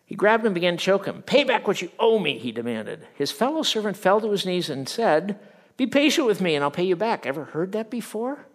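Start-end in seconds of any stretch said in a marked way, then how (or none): 1.2-2.4 high, loud demand; angry demand. Acted. Servant demanding his money, high angry voice. 5.8-7.2 high, loud, fast plea; pleading. Acted. Kneeling servant begging for patience, high urgent plea.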